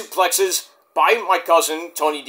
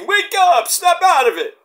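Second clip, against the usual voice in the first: high, loud call to a dazed wrestler; calling out to wake him; 12 semitones higher, 3 dB louder than usual. Retold or imitated. Rizzo calling the knocked-loopy Tony D awake, high loud call. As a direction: shout him awake, pitched way up.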